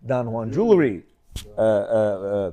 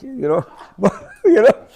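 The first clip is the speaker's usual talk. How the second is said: embarrassed laugh; 8 semitones higher, 5 dB louder than usual. Real, not acted. Embarrassed at restaurant complaint.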